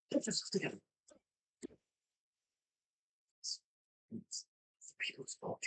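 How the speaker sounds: soft, breathy muttering; muttering to himself. Acted. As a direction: mutter under his breath, half whispered. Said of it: "he sort of says to himself".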